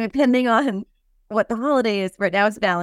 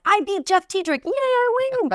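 1 mock triumph; 12 semitones higher than usual. Retelling race to reply.

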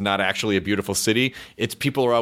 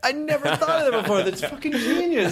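high, delighted disbelief through laughter; real laughing astonishment, pitched way up; amazed laughter; 14 semitones higher than usual. Real, not acted. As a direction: burst out laughing at a revelation. Amazed laughing reaction to accent tip.

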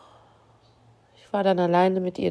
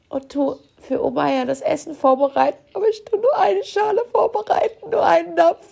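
crying; 7 semitones higher than usual. Real, not acted. Grieving over her dead cat.

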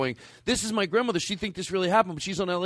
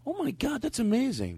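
high, breathy surprise; amazed; 8 semitones higher, 3 dB quieter than usual. Real, not acted. Reacting to the short story time, amazed and high. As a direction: gasp it out in amazement.

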